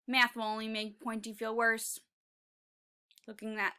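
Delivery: ordinary delivery, nothing marked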